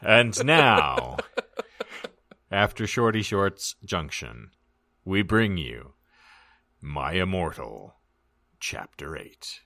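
grand, measured show introduction; solemn title announcement; announcer intro. Acted. Announces the story and chapter.

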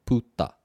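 "Puta" in two separate beats, pu - ta; split into syllables. Shown as a demonstration. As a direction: break "Puta" apart with a gap between pu and ta. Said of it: "two syllables".

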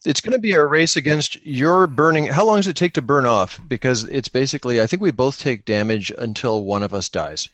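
usual speaking voice

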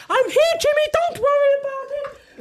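squeaky high imitation of a mate; high mock voice. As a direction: pitch it way up, mocking the mate's big entrance. Quoting mate's grand arrival.

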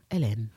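Hélène with a silent H; low, falling "Hélène", like Helen minus the H; French pronunciation. Shown as a demonstration. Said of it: "Think of, like, Helen".

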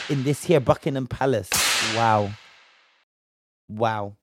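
ordinary talk, nothing marked

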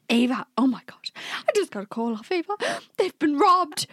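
lightly imitated frantic mom; a hint of a panicked mother; mom panicking. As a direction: rush it like a worried mother. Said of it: "Mom saying to me".

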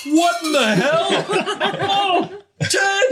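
high, loud startled outcry; shocked worker. Acted. Worker downstairs finds a pig moving, shocked yell. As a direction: cry out in hammed-up alarm. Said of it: "from downstairs, you just hear, like".

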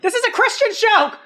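fake-scandalized cry; mock outraged shout. Host pretends to scold the guest, mock outraged shout.

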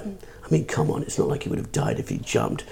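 muttered aside to his wife, whispered and soft; lightly voiced posh man, under his breath; posh sneer. Posh man murmuring to his wife on the bridge, whispered sneer. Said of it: "he was like".

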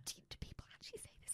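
fast hushed disbelief; whispering. Real, not acted. Disbelief at a word.

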